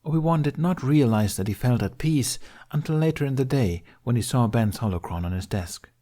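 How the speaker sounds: ordinary delivery, nothing marked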